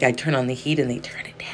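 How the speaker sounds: normal talking voice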